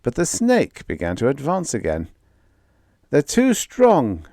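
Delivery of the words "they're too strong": moaning. Acted. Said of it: "moaned Brunet".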